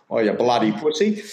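self-mocking Australian bloke, mild teasing; mock Australian accent. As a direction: tease yourself in a mock Australian voice. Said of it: "I've gone Australian".

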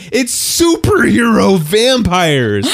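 slow, high, drawn out; emphatic. Real, not acted. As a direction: stretch each word for effect. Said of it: "slow emphatic claim".

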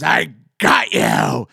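loud, slow menacing pounce; monster growl. Retold or imitated. Brother in a Freddy mask grabbing his little brother, loud and menacing. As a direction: lunge out and snarl it to terrify.

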